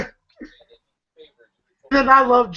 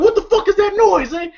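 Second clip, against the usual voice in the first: high-pitched, indignant acted outburst; irate demand; 10 semitones higher than usual. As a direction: imitate an angry man demanding answers. Acting out Joker's angry demand.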